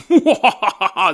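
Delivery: hammed-up villain cackle; evil laugh. Alien mock evil laugh.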